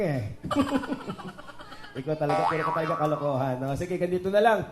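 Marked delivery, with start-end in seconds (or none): 0.3-2.0 breathy, voiceless laugh. Real, not acted. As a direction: let out an amused laugh. Laughing at the echoed tease. (humihingal na tawa)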